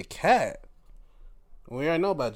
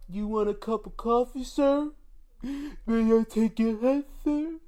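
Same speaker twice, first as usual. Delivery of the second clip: mock polite butler; 7 semitones higher than usual. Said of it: "he's like".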